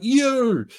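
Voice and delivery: high, drawn-out outraged "you"; retold indignant shout; outraged yell. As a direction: call out the culprit, pitch shooting up. Nico yells at the intruder.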